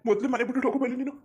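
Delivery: urgent shout. Acted. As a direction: yell at her to get out of the house. Father urges daughter to flee. (ಮಗಳಿಗೆ ಮನೆ ಬಿಟ್ಟು ಹೋಗೆಂದು ಜೋರಾಗಿ ಕೂಗುವ ತಂದೆ)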